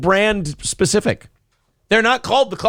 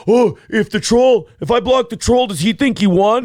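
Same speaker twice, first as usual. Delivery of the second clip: higher-pitched mock fretting over a troll; mocking worried attitude; 4 semitones higher than usual. Host voicing the attitude he advises against, mock fretting. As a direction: act out someone overthinking a troll. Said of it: "you can't get caught in the attitude of".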